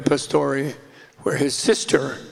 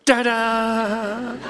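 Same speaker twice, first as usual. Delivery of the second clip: high, bright showman's ta-da; spring out and announce yourself; triumphant ta-da; 6 semitones higher than usual. Retold or imitated. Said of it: "and he goes".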